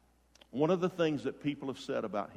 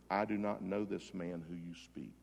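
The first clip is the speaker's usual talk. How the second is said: low, soft reading of an oath; understated denial; 6 semitones lower, 9 dB quieter than usual. Retold or imitated. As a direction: read the denial quietly, voice dropped. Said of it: "he began to curse".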